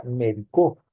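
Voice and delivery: "médico" said as me-di-CÓ, stress on the final O; stress on last syllable. Shown as a demonstration. Teacher shows the past tense, "médico" stressed as me-di-CÓ. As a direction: push the stress of "médico" to the end: CÓ. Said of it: "esa debería dar tilde en la O".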